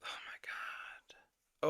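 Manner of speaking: slow, breath-only whisper of disbelief; exasperated whisper. Real, not acted. Reactor worn out by the argument, exasperated whisper. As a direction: whisper it, drawn out and drained. Despairing aside after the rant.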